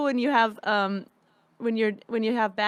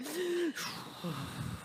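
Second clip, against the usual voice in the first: long breathy straining exhale, imitated; poop exhale; 5 semitones higher, 11 dB quieter than usual. Imitating someone struggling in a stall, long exhale. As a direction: blow out slowly like yoga breath work. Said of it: "It's like the poop exhale".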